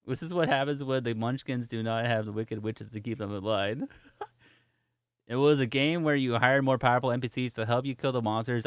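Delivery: plain reading voice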